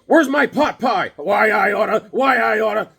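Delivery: loud, high comic bluster; blustering husband. Pretend. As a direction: holler a mock threat as the toy husband. Voices figure as a blustering husband.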